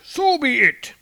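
high, loud mock Odin; hammed-up kingly decree; Odin's voice. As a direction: bellow it up high. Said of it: "Odin's like".

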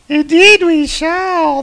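high, slow, loud singsong reply; women in chorus. Acted. The ladies all answering Hercules together, high mock chorus. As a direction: answer in a high put-on group voice. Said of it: "they all say together".

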